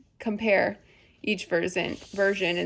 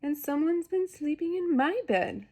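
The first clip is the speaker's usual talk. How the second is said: affronted Mama Bear, pitched up but restrained; medium-sized Mama Bear voice; 7 semitones higher than usual. A narrator's reading. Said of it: "in her medium-sized voice".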